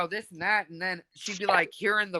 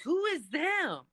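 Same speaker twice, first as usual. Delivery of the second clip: high, baffled disbelief; baffled question; 11 semitones higher, slower than usual. Retold or imitated. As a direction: ask it like nothing makes sense.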